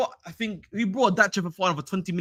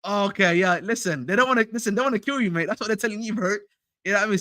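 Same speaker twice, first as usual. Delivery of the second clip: usual speaking voice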